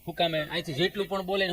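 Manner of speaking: ordinary talk, nothing marked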